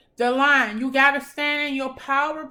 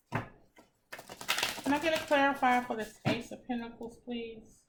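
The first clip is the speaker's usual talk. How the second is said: quiet request; 3 semitones lower, 11 dB quieter than usual. Real, not acted. Asking the tarot deck softly.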